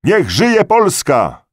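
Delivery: loud, high, slow cheer; patriotic shout. A narrator's reading. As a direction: draw out a full-voiced cheer for the country. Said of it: "krzyknął Kania". (donośny, przeciągły okrzyk)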